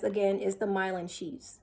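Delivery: usual speaking voice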